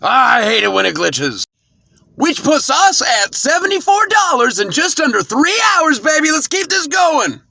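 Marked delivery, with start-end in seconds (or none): 2.1-7.4 excited. Real, not acted. Celebrating earnings total.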